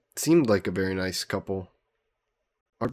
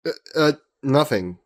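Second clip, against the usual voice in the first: higher and louder stammer, caught off guard; flustered; 6 semitones higher, 5 dB louder than usual. Acted. Host caught out after complimenting his guest, flustered stammer. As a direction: stumble out of it, embarrassed.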